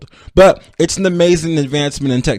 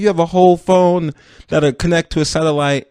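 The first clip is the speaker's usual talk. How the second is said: usual speaking voice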